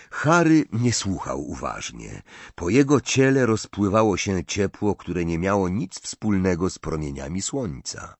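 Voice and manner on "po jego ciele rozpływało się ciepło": usual speaking voice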